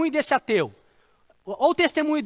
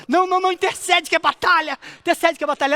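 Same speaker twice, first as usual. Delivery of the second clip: high, loud burst of alarm; retold panicked call to intercede; acted urgent plea; 5 semitones higher, 4 dB louder, faster than usual. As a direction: cut in urgently to rouse a prayer partner. Acted urgent plea to sleeping wife.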